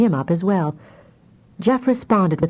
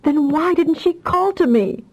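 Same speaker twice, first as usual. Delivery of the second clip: much higher anguished cry; a mother crying out in hurt; 11 semitones higher than usual. A narrator's reading. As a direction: let the question break out of her. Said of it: "the Rowan cried".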